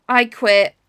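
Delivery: a loud, playful "I quit"; mock exasperated outburst. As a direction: throw up your hands in jokey defeat. Mock despair at friend's build.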